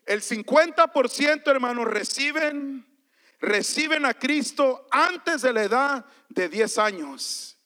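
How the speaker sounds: forceful sermon voice; emphatic preaching. Real, not acted.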